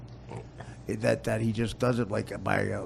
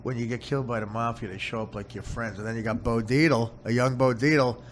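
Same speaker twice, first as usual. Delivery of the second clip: ordinary talk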